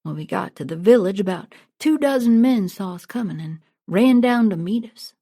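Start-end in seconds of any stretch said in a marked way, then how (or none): none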